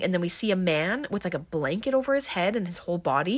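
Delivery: ordinary talk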